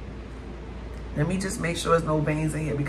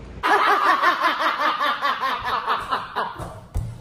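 amused laugh; 6 semitones higher than usual. Real, not acted. Steamy frog meat.

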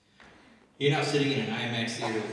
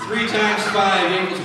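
mock teacher drill; 9 semitones higher, 17 dB louder, much slower than usual. Teacher drilling class, loud.

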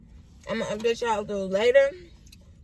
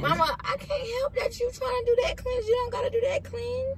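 mock whiny; 13 semitones higher than usual. Teasing mom about her cleanse.

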